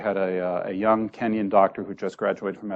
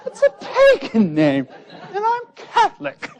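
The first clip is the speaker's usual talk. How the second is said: put-on old demented doctor. Old Scottish doctor muses about his name, high and bemused. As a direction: wonder aloud like a confused old man. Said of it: "And he's there going".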